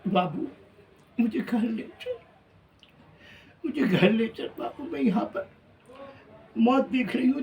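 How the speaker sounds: pleading mother. Retold or imitated. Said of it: "खिड़की से बाहर हाथ निकाल के कह रही थी". (ऊँची, गिड़गिड़ाती माँ की आवाज़)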